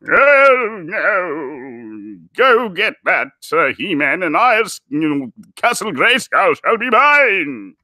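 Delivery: high, booming theatrical wizard; drawn-out, hammed-up villain proclamation; Gandalf. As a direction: proclaim it grandly like an old wizard. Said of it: "they should go Gandalf".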